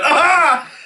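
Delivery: laughing fake yelp of pain; hammed-up cry of pain through laughter; mock pained yelp, laughing.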